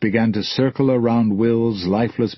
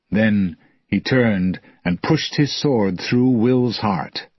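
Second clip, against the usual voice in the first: ordinary delivery, nothing marked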